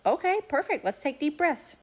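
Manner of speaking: put-on high, chirpy reassurance to a child; bright high-pitched agreement with a little one; encouraging mother. Mother cheerfully answering her upset child.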